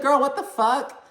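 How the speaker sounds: very high-pitched, incredulous squeal; exaggerated disbelief. Host reacts to a castmate's rude remark, exaggerated disbelief.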